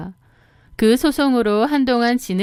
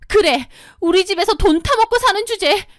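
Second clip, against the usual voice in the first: put-on higher, petulant young woman's voice; sister-in-law; 11 semitones higher, 3 dB louder than usual. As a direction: whine at her to come fetch you. (높은 목소리로 투덜대는 시누이)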